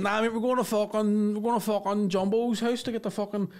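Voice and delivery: high-pitched Belfast lad impression; mock Belfast lad voice; spidey Belfast lad. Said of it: "two of the most spideyest Belfast people".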